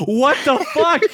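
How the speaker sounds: high, loud disbelief; retold burst of bafflement; incredulous outburst. Caller baffled at being yelled at, incredulous outburst. Retelling baffled reaction to yelling.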